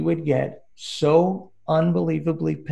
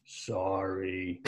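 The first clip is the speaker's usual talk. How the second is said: meek sorry; 7 semitones lower, 10 dB quieter than usual. Retold or imitated. Brother's meek reply.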